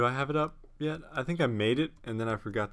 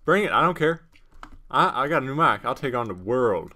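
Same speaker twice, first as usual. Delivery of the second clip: loud bravado; 2 semitones higher, 9 dB louder than usual. Pretend. Gamer boasting about his new mic, loud bravado. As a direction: call out the whole world.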